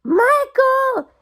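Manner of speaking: high, pleading call of his name; a wife begs her husband, high and anguished; anguished cry. A narrator's reading. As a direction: cry out to stop him. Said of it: "Anne cried".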